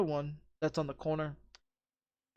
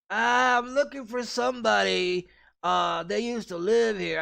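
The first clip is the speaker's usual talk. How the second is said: put-on drunk stranger; 4 semitones higher than usual. Voicing the drunk at the door.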